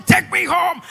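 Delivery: loud mock yell; hammed-up plea, shouted; mock shouting to be taken home; retold shout. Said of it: "The guy was shouting".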